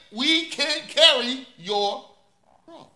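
slow, heavy preaching emphasis; drawn-out emphasis. Acted. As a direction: stretch every word slowly and lean on them. Sermon climax on the cross.

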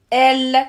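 "L" called out loud and clear; loud L sound. Shown as a demonstration. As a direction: sound out "L" firmly for the child. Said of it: "je dis".

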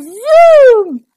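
loud, high storybook zoom; big sound-effect word; excited whoosh. A narrator's reading. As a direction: launch the word upward like a take-off. Door shoots into the air.

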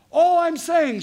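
high, loud mock wife; hammed-up indignant wife. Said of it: "she says".

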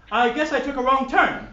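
high, fussy posh gentleman. Acted. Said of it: "yet posh voice rings out".

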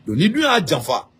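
ordinary talk, nothing marked

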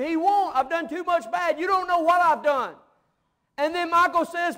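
shouted, high-pitched pulpit dialogue; fiery preacher at the climax; full-force preaching. Real, not acted. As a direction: cry out the promise of salvation at full volume.

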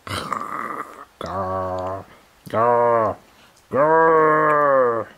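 mock growl. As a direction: growl back at the teething baby. Mock growl with baby biting.

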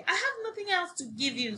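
high, quick, mildly cross protest; understated storybook anger; angry. A narrator's reading. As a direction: blurt it out high and fast. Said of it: "Sophie became angry".

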